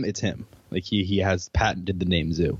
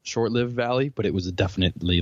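normal talking voice

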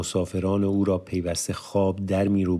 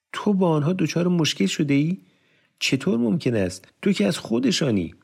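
much higher, stunned disbelief; incredulous; 11 semitones higher than usual. A narrator's reading. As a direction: ask as if it can't be true. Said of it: "ناباورانه پرسید". (پرسش ناباورانه با صدای بسیار بالا)

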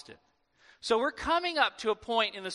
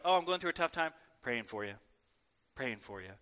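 low, soft churchy murmur, much lower than his own; mock pious voice; 8 semitones lower, 5 dB quieter, faster than usual. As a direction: put on a pious, hushed prayer tone. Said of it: "Pious babble".

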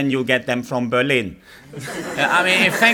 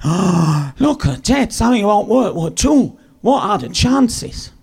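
mock amazement; 6 semitones higher than usual. A newcomer marvelling at a war documentary, mock amazed. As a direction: play it faux-astonished, pitch way up. He mimics his own first-day amazement.

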